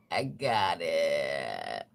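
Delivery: very slow, low, stretched-out groan; drawn-out repeat. Pretend. Joking after clearing a rough throat, drawn-out groan. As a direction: drag out each word way down low.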